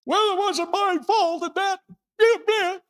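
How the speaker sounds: high, far-off mock whine of someone shifting blame; blame-dodger voice. Preacher mimics a blame-dodger, high and loud. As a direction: play the person who insists it wasn't their fault. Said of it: "And no blaming".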